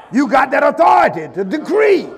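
loud, high preacher's cry; preaching at full force. Acted. Preacher urging the church, at full force. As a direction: drive the exhortation home like a pulpit call.

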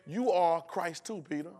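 slow, deliberate answer; Jesus's intended tone. Retold or imitated. Jesus answering Peter in kind, slow deliberate delivery. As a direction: say it slowly, pointedly handing it back. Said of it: "He's saying".

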